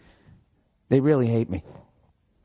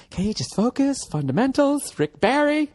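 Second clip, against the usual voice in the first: high, pleading voice; exasperated plea; 12 semitones higher than usual. Retold or imitated. Coach begging a player to focus, high plea. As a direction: beg him to focus.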